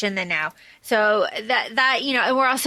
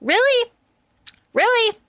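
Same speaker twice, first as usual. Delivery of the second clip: mock outrage, pitched way up; incredulous; 9 semitones higher than usual. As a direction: squeal it in disbelief, you can't believe her choice. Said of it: "you're just like".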